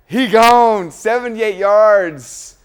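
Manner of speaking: loud, high shout with stretched-out yardage; excited touchdown call. Real, not acted. Host calling a long touchdown run, excited shout. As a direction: holler it like a breakaway play call.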